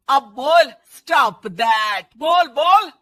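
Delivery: loud, slow, daring yell; shouted challenge. Acted. Mother-in-law yells at daughter-in-law to say it, loud. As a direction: dare her with a yell.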